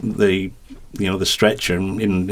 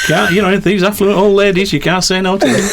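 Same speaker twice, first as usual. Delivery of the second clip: talking through laughter, high and loud; laughing; 11 semitones higher, 4 dB louder than usual. Real, not acted. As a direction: laugh the words out. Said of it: "laughing while telling it".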